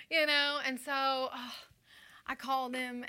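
usual speaking voice

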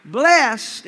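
loud call of blessed; shouted blessed. Shown as a demonstration. Preacher calling blessed for the congregation to repeat. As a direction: hit it hard for the church to repeat. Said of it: "everyone say blessed".

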